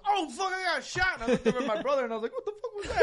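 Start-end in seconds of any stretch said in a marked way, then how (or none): 0.1-1.1 high, slow wounded yelp; mock hurt yelp. Retold victim yelp in game. 2.3-3.0 high, surprised outburst; mock startled. Retold own startled reaction.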